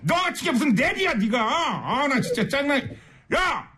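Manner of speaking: high-pitched, hammed-up scolding; exasperated comic tirade; mock angry rant. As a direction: tell off a useless sat-nav in pretend fury. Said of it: "화 좀 내주세요".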